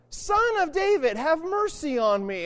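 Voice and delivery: slow, insistent beggar's cry; pleading shout. Acted. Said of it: "he shouted all the more".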